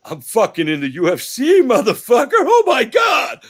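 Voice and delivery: exultant celebration. Acted. A new fighter finally taking in the UFC deal, exultant. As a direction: whoop it up, stretching the last words. Mimics excited celebration of signing.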